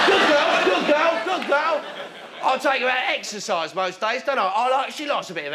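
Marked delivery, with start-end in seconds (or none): 0.1-1.8 high, eager praise; praising a dog. Acted. Owner rewards his dog after obedience, high mock praise. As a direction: repeat it as excited praise to a dog.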